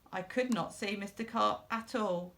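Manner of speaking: plain reading voice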